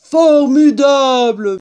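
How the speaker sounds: yelling. A narrator's reading. Badger thrilled by the cider, loud long yell. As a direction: draw it out in a big shout. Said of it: "hurla Blaireau".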